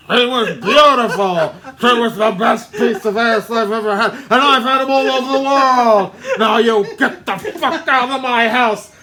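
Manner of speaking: loud, high put-on rage with laughs; hammed-up furious producer. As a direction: rant like an insulted movie mogul. Acted movie rant, very loud.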